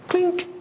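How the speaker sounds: high imitated clink, very short; ping it like a bell; mock clink sound. A high mock clink as the idea lands.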